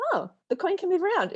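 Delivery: sudden hopeful lift; bright realisation. Retold or imitated. Anxious person realising the coin can move, delighted.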